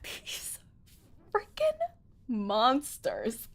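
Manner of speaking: soft, halting, word by word; stunned reproach. Real, not acted. Player blames the chat, stunned reproach. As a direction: let it out quietly, with gaps between words.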